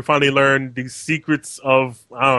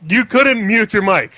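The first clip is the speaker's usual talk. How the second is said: exasperated scolding; 7 semitones higher, 5 dB louder than usual. Real, not acted.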